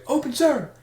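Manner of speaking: high, shouted response; barked military recruit reply. Retold or imitated. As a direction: snap it out like a recruit. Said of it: "and you say".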